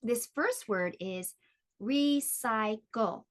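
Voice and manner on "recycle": "recycle" slowly in three separate beats; measured syllable-by-syllable "recycle"; split into syllables. Shown as a demonstration. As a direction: break "recycle" into re, cy, cle. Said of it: "divide words into syllables".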